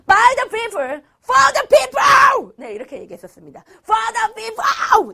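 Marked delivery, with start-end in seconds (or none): 0.0-2.5 climbing to a huge, drawn-out shout; Lincoln's rousing cry. Retold or imitated. Teacher booming Lincoln's words to wake the class. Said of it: "오늘 좀 심하지, 선생님이?". 3.8-5.1 high, drawn-out shout; rousing cry. Retold or imitated. Teacher shouting so students won't doze. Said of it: "졸지 말라고".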